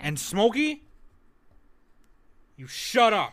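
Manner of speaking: high, loud hammed-up telling-off; mock scolding. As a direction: snap at the cat in pretend anger.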